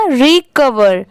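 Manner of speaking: slow teacher model of "recover"; slow, careful "recover" for the class; slow model word. Shown as a demonstration. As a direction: say "recover" slowly, syllable by syllable. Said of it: "दोनों मिला देंगे तो क्या हो जाएगा".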